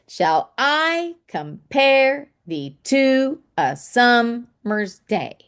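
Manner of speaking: slow, beat out "shall I compare thee to a summer's day" with weight on I, pare, to, sum, day; iambic stress. Shown as a demonstration. Teacher showing iambic pentameter, heavy stress on every second syllable of "shall I compare thee to a summer's day". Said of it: "stress or say loudly, or accent every other syllable".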